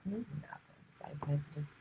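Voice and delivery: whispering. Real, not acted. Whispered card reading.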